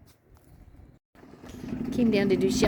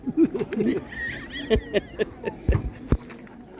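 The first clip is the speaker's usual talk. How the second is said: airy unvoiced laugh, rising; breathy giggle; 5 semitones higher than usual. Real, not acted. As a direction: giggle at your own joke. Laughs after teasing partner.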